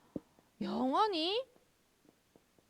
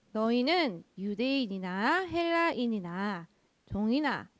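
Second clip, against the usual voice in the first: loud, slow, deliberate verse recital; measured Bible reading, building louder at the end; scripture reading; 7 dB louder, slower than usual. Acted. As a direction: proclaim each phrase slowly and loudly. Reads verse aloud to children.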